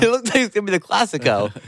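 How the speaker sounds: high, laughing with glee; gleeful laughing delivery. Real, not acted. Laughing, anticipating the rival.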